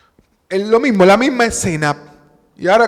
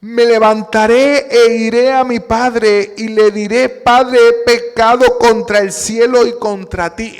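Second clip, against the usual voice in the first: loud, raised reading of the verse; scripture read out with force; 3 semitones higher, 7 dB louder than usual. Acted. Preacher booming the son's confession, louder. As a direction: proclaim the prodigal son's words to the congregation. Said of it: "Mire lo que dice la Biblia".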